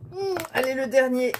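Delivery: grunting while forcing it; straining with effort. Real, not acted. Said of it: "c'est dur".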